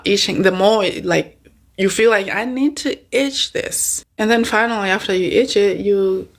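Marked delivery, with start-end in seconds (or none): 2.3-4.0 high, slow, craving voice; needy urge. Retold or imitated. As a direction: say it like you can't resist the itch. Imitating the urge to scratch.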